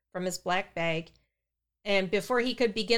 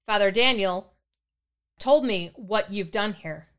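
usual speaking voice

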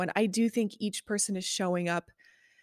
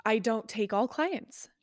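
ordinary talk, nothing marked

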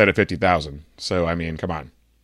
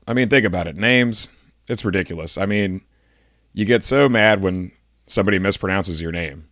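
normal talking voice

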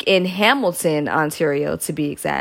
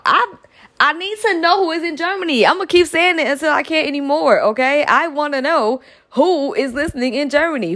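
high, eager calling out to listeners; playful excited questioning; 7 semitones higher than usual. Real, not acted. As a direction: call out to them, dying to know. Eager call-out to German listeners.

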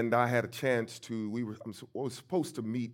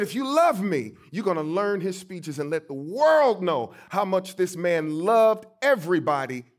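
loud, raised, fervent urging; great-grandmother's charge; 6 semitones higher, 7 dB louder than usual. Retold or imitated. Great-grandmother charging the boy to learn his speeches, loud and impassioned. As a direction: preach her plea forcefully, dropping at the end. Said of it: "So she said".

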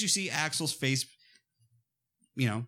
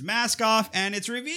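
rising, higher-pitched suspense build-up; dramatic reveal; 8 semitones higher than usual. Pretend. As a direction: tease the big unmasking. Mock dramatic unmasking build-up.

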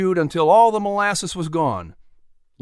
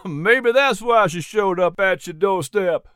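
raised, amused character voice; amused chuckle; 4 semitones higher than usual. A narrator's reading. Jack teasing about the apple, amused voice. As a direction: say it just after a chuckle. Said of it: "Jack said with a chuckle".

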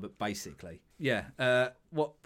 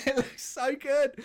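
gushing; 14 semitones higher, 4 dB louder, slower than usual. Real, not acted. Gushing about screenshots.

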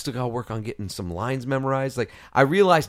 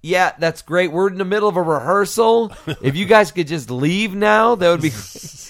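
sarcastic; 4 semitones higher, 6 dB louder than usual. Retold or imitated. Joe Porter tells visitors to leave.

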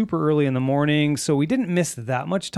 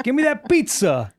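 New Yorker; 9 semitones higher than usual. Retold or imitated. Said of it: "New Yorker".

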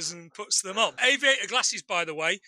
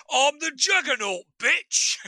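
quoting the Juggernaut, a bit higher and louder, mock tough; Juggernaut quote; 6 semitones higher, 3 dB louder, slower than usual. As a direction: bark the famous movie line in fun. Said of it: "what else he's in".

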